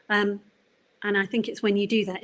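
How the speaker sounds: ordinary talk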